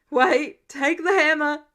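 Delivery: loud strained plea; pained call. Acted. Tony pinned by the hammer calling Peter back, loud pained call. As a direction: call after him through the pain.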